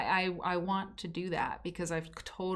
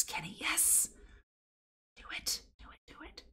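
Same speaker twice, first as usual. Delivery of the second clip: breathy whisper egging Kenny on; whispered urging; 11 semitones higher, 10 dB quieter than usual. Pretend. Host whispers to Kenny, urging him on. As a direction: hush it, like cheering someone on secretly.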